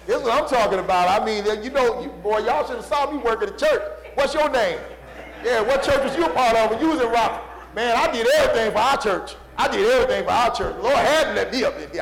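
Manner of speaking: loud, high bragging church member; mock boaster. Preacher playing a self-praising saint in heaven, loud. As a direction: brag about your church work, loud and proud.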